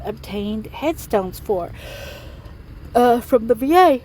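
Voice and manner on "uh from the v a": words stretched by a yawn mid-sentence; yawning while reading off a volunteer's news; talking through a yawn. Real, not acted. Groundskeeper vlogging to viewers, yawns mid-sentence.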